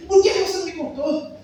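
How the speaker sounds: mock furious accusation; imitating angry wife. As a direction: play the wife snapping at her husband. Wife confronting him in retold argument.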